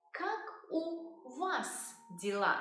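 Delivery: slow, careful teacher's model; slow model phrase. Shown as a demonstration. Teacher modelling a Russian phrase slowly. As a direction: say it slowly for learners. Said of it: "we will say".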